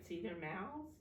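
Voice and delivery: usual speaking voice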